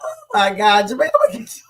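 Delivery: real laughing fit, voice shooting up; laughing hard. Real, not acted. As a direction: barely get the words out for laughing. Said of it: "'cause I'm laughing so hard".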